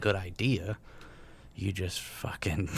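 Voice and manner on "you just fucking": whispering. Real, not acted. Whispered aside.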